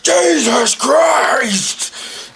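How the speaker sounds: high drawn-out yelp of surprise; startled shout. Retold or imitated. Startled guy yelling at the girl who tripped, drawn-out shout. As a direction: blurt it out, startled and loud.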